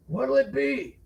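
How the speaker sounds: high gruff barman; bartender voice. A narrator's reading. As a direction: barkeep asking for an order. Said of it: "the bald, bearded barman asked".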